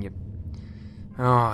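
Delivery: ordinary delivery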